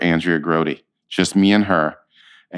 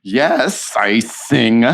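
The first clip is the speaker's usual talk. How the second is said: eager yes; 4 semitones higher, 3 dB louder, much slower than usual. Retold or imitated. Eager reply to director.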